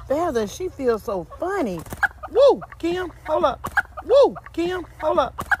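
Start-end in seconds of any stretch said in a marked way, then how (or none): 2.3-5.5 startled woo and hold up. Real, not acted. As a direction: yelp in nervous surprise. Startled holding a chicken.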